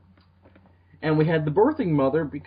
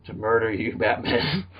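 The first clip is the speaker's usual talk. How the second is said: Aquaman voice; 8 semitones lower than usual. Retold or imitated. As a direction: rumble it out like a dim sea god. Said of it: "That's how Aquaman's gonna talk".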